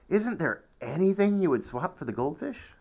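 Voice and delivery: put-on high, eager child's plea; boy bargaining hopefully for the goldfish; hopeful asking. A narrator's reading. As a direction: coax the other boy, pitch up. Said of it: "I asked Nathan".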